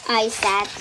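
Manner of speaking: ordinary delivery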